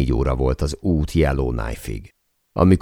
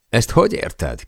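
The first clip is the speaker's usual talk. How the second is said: higher, lightly teasing question; jokingly; 6 semitones higher than usual. A narrator's reading. Per teases the father, a higher joking question. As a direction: toss it back as a mild joke. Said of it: "kérdezte tréfásan Per".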